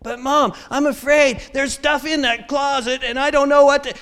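put-on high, loud kid making excuses; whining child. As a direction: play a kid pleading a fake fear. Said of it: "I tried that kind of stuff on my parents".

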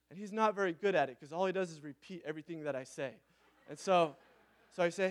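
ordinary talk

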